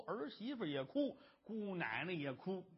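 usual speaking voice

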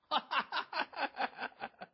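high staccato stage laugh; hearty, hammed-up run of ha-ha-ha; villain's gloating laugh. Gang boss arrives to see his prize, gloating laugh.

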